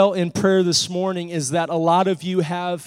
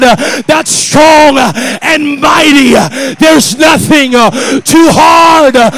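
full-force preaching; 8 semitones higher, 12 dB louder than usual. Real, not acted. Preacher proclaiming God's might to the church, full-force preaching. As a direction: thunder it out to the congregation.

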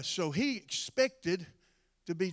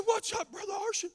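mock disbelief; 12 semitones higher than usual. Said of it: "He said".

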